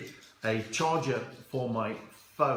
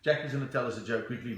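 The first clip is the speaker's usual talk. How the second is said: ordinary talk, nothing marked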